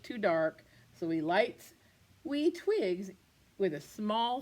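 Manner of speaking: plain reading voice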